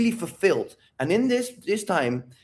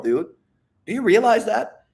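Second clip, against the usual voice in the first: normal talking voice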